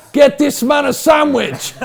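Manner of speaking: high, slow, very loud shout; joking shout. Real, not acted. Joking to the room about a rumbling tummy, shouting. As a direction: yell it out over the laughter, dragged out.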